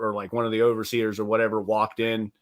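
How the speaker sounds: ordinary delivery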